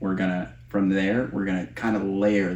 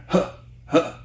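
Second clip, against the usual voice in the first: breathy huffs; 9 semitones higher than usual. Shown as a demonstration.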